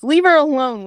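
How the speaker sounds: lightly acted angry order; mild growl. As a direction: snap it protectively, only mildly growled. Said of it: "Steele growls and says".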